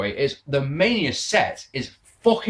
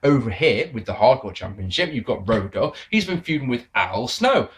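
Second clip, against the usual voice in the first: usual speaking voice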